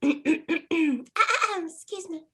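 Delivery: put-on high, slow, cute anime girl; sweet kawaii anime voice. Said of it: "The anime girl voice".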